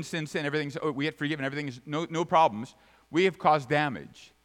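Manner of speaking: normal talking voice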